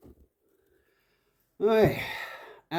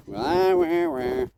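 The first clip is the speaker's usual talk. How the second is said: mocking animals; 5 semitones higher, much slower than usual. Mocking the noisy farm animals.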